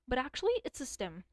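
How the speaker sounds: usual speaking voice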